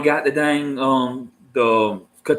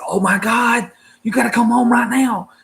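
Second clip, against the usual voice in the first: much higher, urgent imitation of the wife; panicked wife; 12 semitones higher than usual. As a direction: play the frantic call from home, pitched way up. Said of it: "She said".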